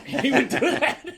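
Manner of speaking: high laughing remark; giggling while saying it. Real, not acted. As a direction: laugh through it.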